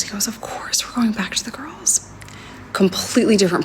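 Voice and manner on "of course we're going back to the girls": soft, higher, whispery mock reassurance; hushed imitation. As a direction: imitate him softly placating, mostly on breath. Retells him suddenly turning agreeable.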